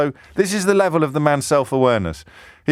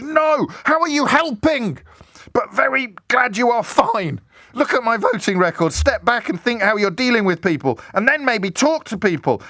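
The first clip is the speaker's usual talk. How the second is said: shrill mock rant; 10 semitones higher than usual. Host mocking an MP's ranting text, high pompous whine. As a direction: ham up his wounded pride.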